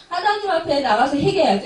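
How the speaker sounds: rising preacher's fervour on 하나님; fervent loud call. Real, not acted. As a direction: proclaim it to the congregation with a laugh in it. Preacher repeats the call to repent.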